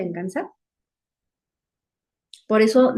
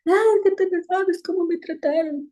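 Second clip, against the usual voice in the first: much higher whimpering complaint; mock whining; 9 semitones higher than usual. Preacher mocks a hurt person who cries, with a high whiny voice. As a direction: whine like someone about to cry. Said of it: "Y luego hasta lloran".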